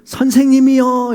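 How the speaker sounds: high, loud, drawn-out appeal; disciples' desperate call. Acted. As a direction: call out to the teacher in need. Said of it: "믿음 없음을 강조하기 위해서".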